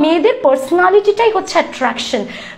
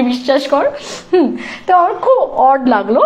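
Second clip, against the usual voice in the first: ordinary talk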